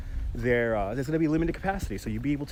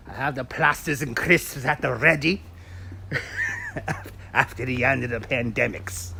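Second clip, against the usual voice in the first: a light put-on British accent; mock British accent. Streamer answers a chat joke in a light mock British accent. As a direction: chirp it in a jokey British accent. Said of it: "I don't know what kind of accent that was".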